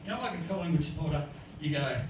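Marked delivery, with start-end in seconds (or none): none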